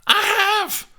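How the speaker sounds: high, loud eager burst; excited reply. A narrator's reading. Juliet answers her nurse, excited. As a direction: blurt it, thrilled. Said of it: "Steph replied excitedly".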